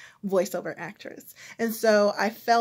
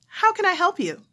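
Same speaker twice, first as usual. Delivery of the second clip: much higher and louder, steering back to business; How can I help you pushed up high and loud to move on; pushy bright operator; 9 semitones higher, 5 dB louder than usual. Retold or imitated. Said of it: "phone operator".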